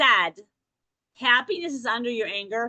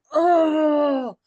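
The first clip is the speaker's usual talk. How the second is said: loud mock whine, pitched up; whiny outcry; 4 semitones higher, 6 dB louder than usual. Coach acting out a driver parked far away, loud whining cry. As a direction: groan out loud like a put-upon complainer. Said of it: "And you're like".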